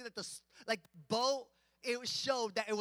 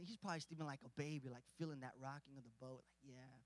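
very soft and low, drifting off; drowsy contentment; 10 semitones lower, 14 dB quieter, faster than usual. Acted. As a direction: murmur it quickly, trailing away like a rocked baby. Voicing Jesus dozing on boat.

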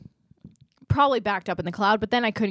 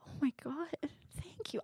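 soft unvoiced whisper of relief; whispered thanks; 6 semitones higher, 16 dB quieter than usual. Retelling her thanks to the Southern agent, whispered. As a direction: breathe it out in awed gratitude. Grateful reply retold.

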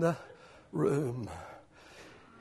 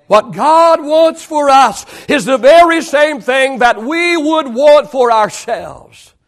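slow, raised emphatic restatement; emphatic preaching; 8 semitones higher, 8 dB louder, slower than usual. Real, not acted. Preacher restating his key point to note-takers, emphatic. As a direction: proclaim it slowly, stretching each phrase.

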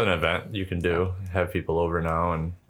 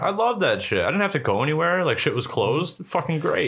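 excited; 9 semitones higher than usual. Real, not acted. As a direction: gush about how good it was. Gleeful about lockdown.

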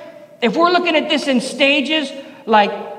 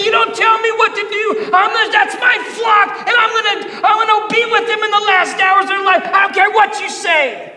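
defiant shout; 6 semitones higher than usual. Pretend. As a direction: shout them down, standing your ground. Acted defiant confrontation with authorities.

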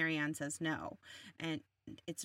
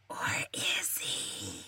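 low, slow, breathy whisper of suspense; dramatic whisper; 12 semitones lower, much slower than usual. Pretend. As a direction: hush it like a soap opera cliffhanger. Dramatic twist in the plot recap.

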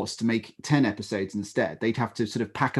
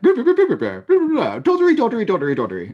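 high, loud squeaky babble mocking posh scientists; hammed-up comic chatter, much higher and louder; mock posh English babble; 12 semitones higher, 7 dB louder than usual. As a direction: squeal the nonsense like a caricature British boffin. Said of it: "Very good".

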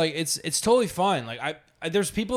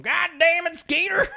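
put-on high yelling hillbilly accent; 4 dB louder than usual.

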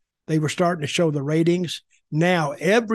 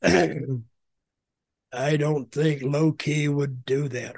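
real deep voice, very slow; slow, deep-voiced impersonation; Low-Ki; 3 semitones lower, much slower than usual. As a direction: drop it low and drag out each word. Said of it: "in that real deep voice".

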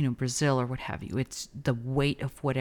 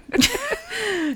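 high laugh; 3 dB louder than usual. Real, not acted. Laughing at being noticed.

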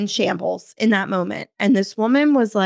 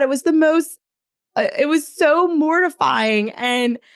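ordinary talk, nothing marked

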